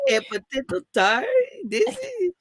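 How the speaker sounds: a loose, hammed-up Italian accent, pitch jumping up high at the end; teasing her friend with a made-up Italian customer voice; mock Italian accent. Customer puzzled by a potato tart, in a hammed-up Italian accent. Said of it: "All the Italians coming to get that".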